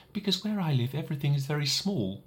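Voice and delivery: put-on high child's voice, matter of fact; small boy explaining simply; little prince voice.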